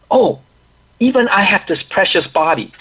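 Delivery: imitated eureka moment, high and loud; loud, high-pitched exclamation of discovery; excited realization. Acts out the Brahman's sudden realisation.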